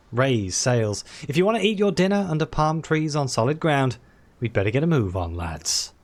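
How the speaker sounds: ordinary delivery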